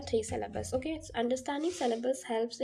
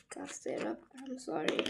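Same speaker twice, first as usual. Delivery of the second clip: hoarse whisper; 6 dB quieter, slower than usual. Real, not acted. After coughing fit.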